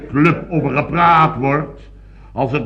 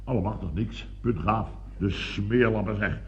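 low, soft disbelief; quietly stunned; 5 semitones lower, 9 dB quieter than usual. Acted. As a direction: sink into dismayed disbelief. Stunned to find safe untouched.

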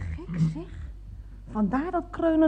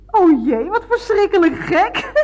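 loud, slow burst of dismay; shocked outburst; 4 semitones higher, 7 dB louder, slower than usual. Acted. Caller on the phone to the garage, loud slow dismay. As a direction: blurt it out, appalled.

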